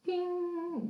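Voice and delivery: high, ringing "ping" sound; imitated ping. Line reaches the green dot, imitated high ping. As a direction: sing out a high electronic ping. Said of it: "and it goes ping".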